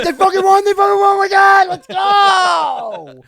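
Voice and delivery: high, full-volume celebration yell; reenacted euphoric shouting, last word stretched out; ecstatic victory shout. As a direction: scream it like your team just won. Retells shouting at the win.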